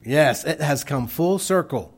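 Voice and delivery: ordinary delivery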